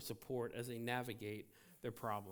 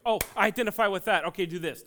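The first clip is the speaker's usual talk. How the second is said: eager interruption; 6 semitones higher, 13 dB louder, faster than usual. Retold or imitated. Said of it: "you're like".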